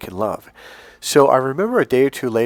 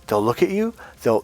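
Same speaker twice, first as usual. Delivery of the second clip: usual speaking voice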